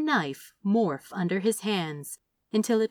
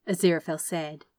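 usual speaking voice

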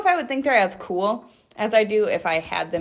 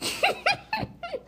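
high giggle; 5 dB quieter than usual. Real, not acted. Laughing at chewing candy.

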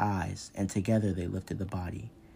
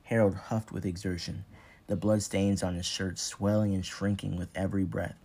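plain reading voice

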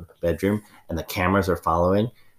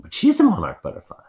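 indignant outburst; 13 semitones higher, 5 dB louder than usual. Acted. Mother insists, voice rising.

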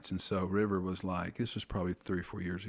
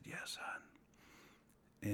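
whisper; 16 dB quieter than usual. Retold or imitated. Father whispering back in dark.

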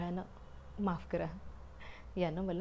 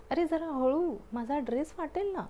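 raised, high protest: careful, my dress will tear; high protesting cry; 7 semitones higher than usual. Acted. Umbrella squeals at its careless owner to go gently.

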